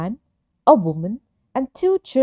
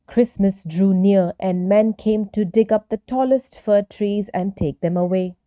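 plain reading voice

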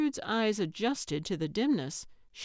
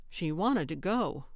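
ordinary delivery

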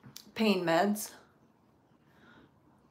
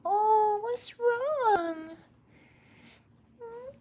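high, worried coo; 11 semitones higher, much slower than usual. Real, not acted. Woman asking a crying cat what's wrong in baby talk.

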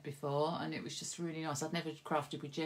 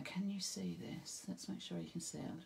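whispered; 8 dB quieter than usual. Real, not acted. Checking camera frame.

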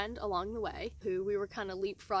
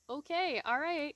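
higher, awkward brush-off; awkward okay; 7 semitones higher, 5 dB louder than usual. Retold or imitated. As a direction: back away politely from the weirdo. Awkward reaction to grunt.

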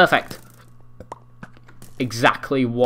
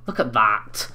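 ordinary talk

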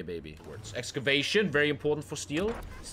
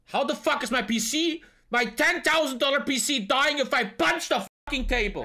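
outraged; 11 semitones higher, 4 dB louder than usual. Real, not acted. PC crashed after punching table.

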